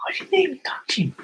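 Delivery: high thin whisper of innocence; high whispery mock-innocent voice; squeaky whispered denial. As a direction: whisper it like a guilty kid. Repeated same denial.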